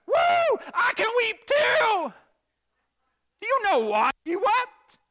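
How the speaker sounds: high, very loud mock-triumphant shout; over-the-top hollering. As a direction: whoop and holler it like an overexcited reader. Mock eager reader hollers over verse.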